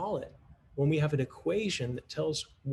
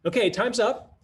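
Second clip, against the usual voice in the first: calling time; 9 semitones higher, 6 dB louder than usual. Shown as a demonstration. Teacher calling class back.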